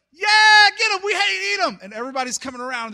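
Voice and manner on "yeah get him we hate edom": very high, full-out cheer; crowd cheering. Retold or imitated. Townsfolk cheering against Tyre, shouting. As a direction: roar approval like an excited mob. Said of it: "And everybody cheers".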